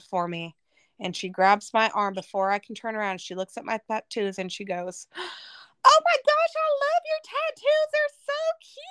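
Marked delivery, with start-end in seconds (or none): 5.1-8.8 gushing high Southern drawl, far above her own voice; cute Southern drawl. Retold or imitated. Church girl gushing over her tattoos in Southern drawl. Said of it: "in their little cute Southern drawl".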